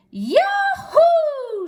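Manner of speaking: high, loud cowboy whoop; joyful shout. Acted. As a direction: holler it out with glee. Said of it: "shouted Bubba".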